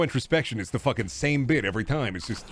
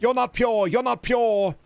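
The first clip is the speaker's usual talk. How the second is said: mocking purity tester; 10 semitones higher, slower than usual. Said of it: "people doing purity tests and being like".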